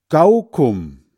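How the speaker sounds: put-on "Gaukum" said loud and high, a child's mangled Kaugummi; garbled word. Hans Frieder mangling the word for the girl, "Gaukum" blurted loud. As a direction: blurt "Gaukum" with syllables swapped. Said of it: "Aber das ist nicht richtig".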